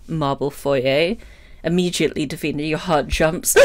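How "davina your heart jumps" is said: usual speaking voice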